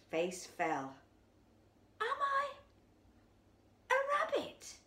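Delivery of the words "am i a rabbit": high, shaky frightened question; stammering. Acted. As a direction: ask it in sudden fear. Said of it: "he stammered".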